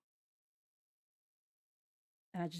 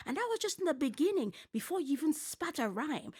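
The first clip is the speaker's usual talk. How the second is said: much higher and louder, rapid-fire anger; heated rant; 8 semitones higher, 5 dB louder than usual. Real, not acted. Ranting at a contestant on the show, heated rant. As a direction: let the fury spill out.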